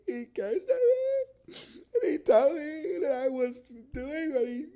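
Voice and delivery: fake-cry about being yelled at; mock whiny employee. Comic mocking an employee upset about a yelling boss. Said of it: "I would have been able to take it better".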